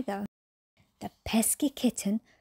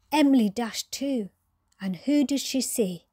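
ordinary delivery

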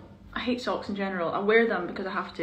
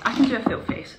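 ordinary talk, nothing marked

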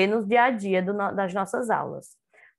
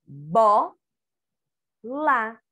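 BO, long pause, LÁ; each syllable of bola on its own; separated syllables. Shown as a demonstration. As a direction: say Bo and lá as two isolated syllables. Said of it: "A sílaba de bola".

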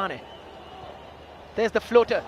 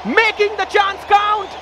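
long goal yell; 7 semitones higher than usual. Real, not acted. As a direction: roar the goal home. Goal scored in cup final.

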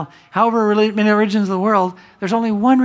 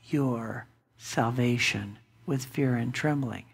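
lower, softer, slow; quiet and grave; 8 semitones lower, 9 dB quieter, slower than usual. Retold or imitated. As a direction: drop to a hush on fear and trembling. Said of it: "Paul writes it this way".

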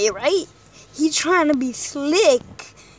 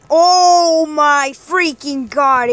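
loud, slow exasperated groan; exasperated yell; 12 dB louder, much slower than usual. Real, not acted. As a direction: drag it out in disbelief.

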